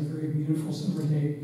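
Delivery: ordinary talk, nothing marked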